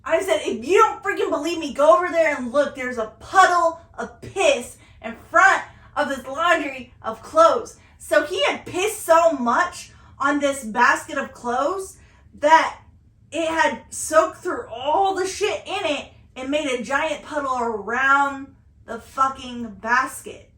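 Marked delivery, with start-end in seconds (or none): 0.4-7.8 loud, emphatic exasperated order; mad. Retold or imitated. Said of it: "I was so mad". 8.0-20.5 real indignation, loud and emphatic; re-mad. Real, not acted. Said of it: "I think I just got re-mad".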